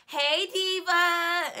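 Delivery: high, loud, drawn-out sing-song hello; gushing caller greeting. Retold or imitated. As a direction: stretch it high like a gushing fan. Caller greets her on phone.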